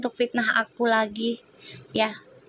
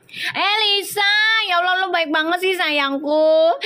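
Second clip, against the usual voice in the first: gushing thanks; 7 semitones higher than usual. Real, not acted.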